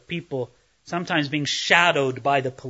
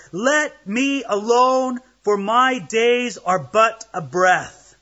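dramatic scripture reading; 4 semitones higher than usual. Acted. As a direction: read it as Job's anguished cry to God. Reads Job's lament aloud.

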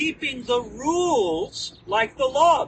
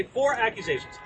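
ordinary talk

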